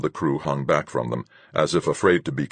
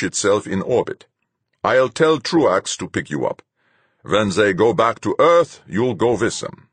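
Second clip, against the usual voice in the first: commanding captain, cold; stern captain; 7 semitones higher, 5 dB louder than usual. A narrator's reading. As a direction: lay it out like a hard captain. Captain Fuchs' voice.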